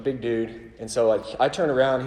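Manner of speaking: ordinary delivery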